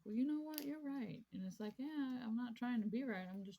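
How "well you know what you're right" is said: quoting conceding friends. Retold or imitated. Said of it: "they're like".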